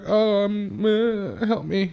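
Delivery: high, slow helpless whine of a customer; high mock customer. Service writer mimics a helpless customer, high and slow. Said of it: "She's like".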